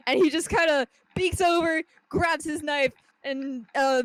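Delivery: usual speaking voice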